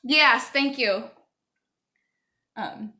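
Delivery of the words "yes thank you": louder, slow, pointed thanks; loud, drawn-out thank you; firm dismissal. Real, not acted. Dismissing interrupting child.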